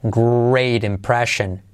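slow careful model phrase; teacher modelling slowly; slow demo. Shown as a demonstration. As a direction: say it slowly for learners.

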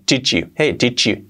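fuse "did you" into "chichu"; merged ch sound. Shown as a demonstration. Teacher showing native merging, ch-sound "chichu". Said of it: "como si fuera una H".

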